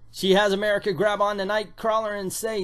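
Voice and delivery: ordinary talk, nothing marked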